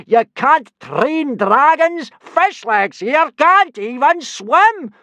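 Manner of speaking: slow, very loud, high-pitched tirade; furious drill-master's bellowing; Gobber roaring. A narrator's reading. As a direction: roar each word in outrage. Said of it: "roared Gobber".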